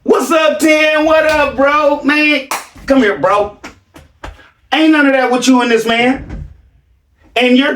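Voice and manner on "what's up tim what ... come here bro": mock buddy greeting.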